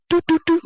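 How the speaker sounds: high, loud mock beeps; beeping noises. Imitating Jabari pressing the fake bomb, high beeps. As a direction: beep like a bomb keypad.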